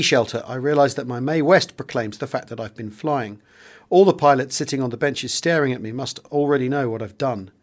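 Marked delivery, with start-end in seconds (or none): none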